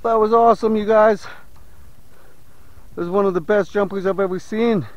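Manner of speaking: ordinary talk, nothing marked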